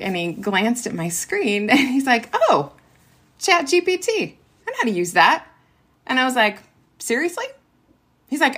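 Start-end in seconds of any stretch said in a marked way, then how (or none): 2.3-5.5 high, casual oh-I-know-that; mock teen swagger; breezy teen brag. Son's casual reply retold. 7.0-7.4 high, soft disbelieving seriously; mock disbelief; astonished. Mother's astonished reaction retold.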